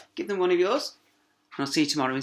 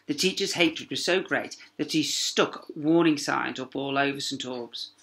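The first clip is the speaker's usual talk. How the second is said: ordinary delivery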